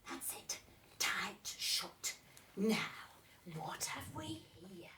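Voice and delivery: hushed secretive father's whisper. Acted. Father whispering to Betsy while she hides her eyes. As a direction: breathe it like a dad sharing a secret.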